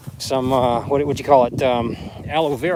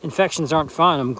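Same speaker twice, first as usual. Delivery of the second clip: ordinary talk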